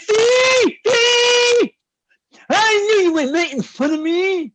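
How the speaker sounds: high loud complaint; offended customer shouting at the bartender; high indignant shout. Acted. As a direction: cry out that he was mocked.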